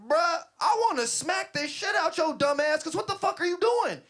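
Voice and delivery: high, loud angry tirade; enraged yelling. Retold or imitated. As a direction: yell a threat at the careless driver. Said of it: "I got out the car yelling".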